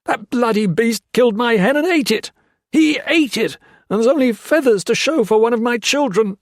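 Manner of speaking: high, loud furious rant; screaming. A narrator's reading. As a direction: shriek it in outrage. Foster raving about his hen.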